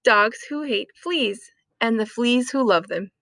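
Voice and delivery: ordinary delivery, nothing marked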